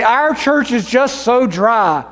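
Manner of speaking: slow, drawn-out whining churchgoer; a slow, whining complaint; whiny complaint. Acted. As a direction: moan it slowly like a bored member. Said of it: "We might say".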